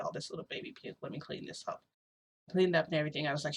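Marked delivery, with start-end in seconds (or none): 0.0-1.8 very soft, low, barely voiced murmur; under the breath; hushed cooing to baby. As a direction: murmur it softly while tending a baby. Cleaning up after the baby.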